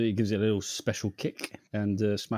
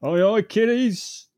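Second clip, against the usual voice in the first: loud, high, drawn-out Cockney shout; mock Cockney accent; 10 semitones higher, 8 dB louder, slower than usual. Riffing on the neighbour calling kids, Cockney holler. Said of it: "in his fucking Cockney accent".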